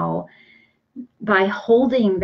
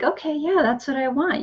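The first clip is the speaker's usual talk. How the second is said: mildly excited; 7 semitones higher, faster than usual. Retold or imitated. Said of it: "You might get excited".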